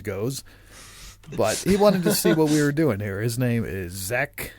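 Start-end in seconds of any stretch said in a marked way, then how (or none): none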